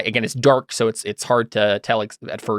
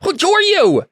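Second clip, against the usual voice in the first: high, loud flustered yelp; startled; 5 dB louder than usual. Acted. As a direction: blurt it out as a fop caught off guard. Startled noble caught urinating.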